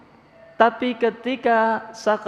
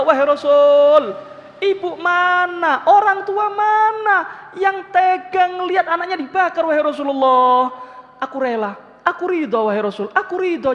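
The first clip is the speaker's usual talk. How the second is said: desperate pleading; 9 semitones higher, 3 dB louder than usual. Acted. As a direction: beg for your son's life. Mother begs Prophet to spare son. (ibu memohon dengan panik, tinggi dan keras)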